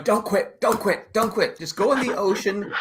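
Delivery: high coaxing voice. Retold or imitated. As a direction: urge him on in a high voice. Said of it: "I was, like, going".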